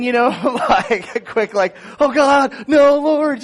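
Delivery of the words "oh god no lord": high, loud panicked plea; panic praying. Retold or imitated. Going under anesthesia, loud panicked praying to God. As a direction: beg God in a mock panic. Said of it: "panic praying".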